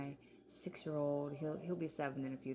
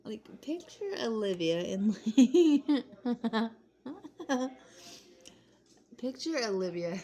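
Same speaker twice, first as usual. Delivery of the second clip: loud and higher, breaking into laughter; laughing through words; 7 semitones higher, 11 dB louder, slower than usual. Real, not acted. Vlogger picturing Olivia as a guard, laughing loudly. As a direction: talk while cracking up.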